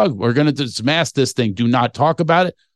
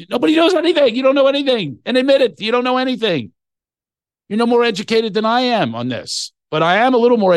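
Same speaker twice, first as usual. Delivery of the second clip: heated high rant; 10 semitones higher than usual. Real, not acted. Man ranting at so-called experts, high and heated. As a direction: hammer it home that nobody knows anything.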